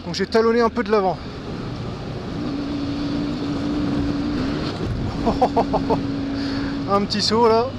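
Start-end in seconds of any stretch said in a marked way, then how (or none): 1.2-6.9 short laugh. Real, not acted. Laughs after a near miss.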